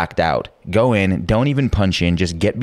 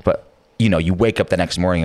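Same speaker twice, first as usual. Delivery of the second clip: ordinary delivery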